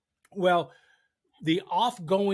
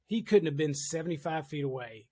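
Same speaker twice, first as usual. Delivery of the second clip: normal talking voice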